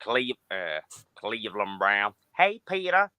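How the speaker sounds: low, soft cartoon neighbour voice; lightly voiced Cleveland Brown. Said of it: "I can do Cleveland".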